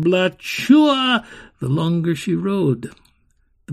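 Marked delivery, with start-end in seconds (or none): 0.0-1.2 high, loud explosion call; firework boom. Acted. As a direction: burst it out like fireworks. Fireworks again.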